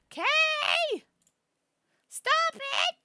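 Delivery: high, loud yelp at a chat member; real playful shout. Real, not acted. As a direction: cry out in mock protest.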